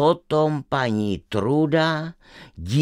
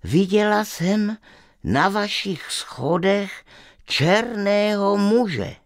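trembling voice; 8 semitones higher than usual. Acted. Girl tells the witch what she saw, mildly trembling. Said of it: "řekla dívka rozechvělým hlasem".

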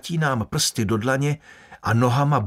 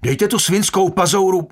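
higher, louder angry yell; shouted protest; 7 semitones higher, 6 dB louder than usual. A narrator's reading. Guard yelling at the prisoner to take his hand away, shouting. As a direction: bark it out in shock and outrage. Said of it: "vykřikl jsem".

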